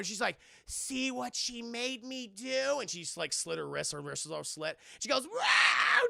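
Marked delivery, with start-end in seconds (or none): 0.6-2.8 put-on slow, higher, eerie child voice; mock creepy ghost child; creepy ghost girl. Ghost girl speaks in retold film. 5.3-6.1 loud, very high yelp; hammed-up startled cry; startled shout. Startled cry in retold film.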